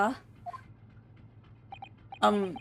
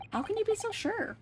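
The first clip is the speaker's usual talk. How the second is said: high companion voice; 10 semitones higher, 4 dB quieter than usual. Acted.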